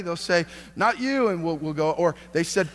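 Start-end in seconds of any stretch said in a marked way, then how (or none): 0.7-1.3 mock-defiant kid's comeback, pitched high; quick retold refusal, higher than usual; defiant retort. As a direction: toss back a playful refusal. Boys refusing to give in.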